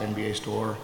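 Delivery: ordinary talk, nothing marked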